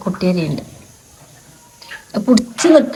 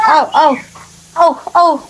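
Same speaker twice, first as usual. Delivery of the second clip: crow call; 6 semitones higher, much slower than usual. Retold or imitated.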